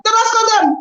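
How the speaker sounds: commentator's stunned echo; bigger mock disbelief. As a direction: push the repeat further into shock. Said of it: "Do am in the pidgin lifestyle".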